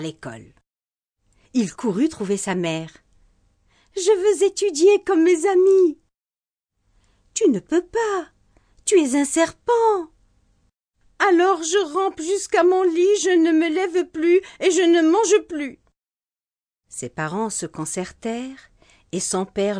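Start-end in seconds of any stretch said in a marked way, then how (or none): 4.0-6.0 put-on high, louder tearful boy's plea; a child crying to join his friends. Child pleads to go to school. 7.4-10.1 higher, louder mother shouting no; mother's raised-voice refusal; exasperated mother. Acted. 11.3-15.8 put-on higher, louder pouty little boy; a sulky boy vowing to stay in bed; sulking child. Child sulks again.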